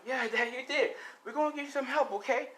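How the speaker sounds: high, acted tearful pleading; tearful son. Son promising his injured dad help, high tearful voice. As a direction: pitch it way up to comfort Dad. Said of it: "And she's crying".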